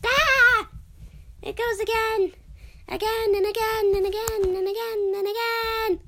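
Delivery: high startled cries; yelping at the noise; alarmed shouting. Real, not acted. Alarmed by repeated boom.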